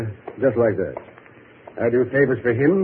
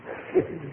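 sly chuckle; 6 semitones higher, 9 dB quieter than usual. Acted. Villain greets the room.

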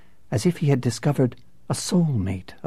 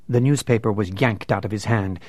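ordinary delivery, nothing marked

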